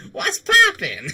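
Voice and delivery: mock yell, very high and loud; catchphrase shout. Streamer blurting a catchphrase for laughs, high playful shout. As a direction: shriek it as a jokey catchphrase.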